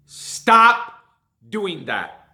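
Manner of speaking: sudden loud stop; stern, punchy command. Real, not acted. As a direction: bark the word stop at the drivers. Scolding drivers in a rant.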